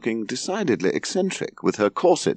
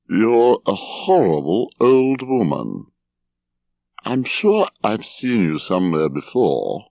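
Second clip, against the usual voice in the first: put-on slow, slurring drunken man; bleary drunk; slower than usual. A narrator's reading. Drunken son peering at his mother from bed, bleary and slow. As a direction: draw it out, groggy and tipsy. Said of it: "peered blearily at Mother".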